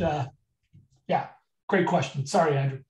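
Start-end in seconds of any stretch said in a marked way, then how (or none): none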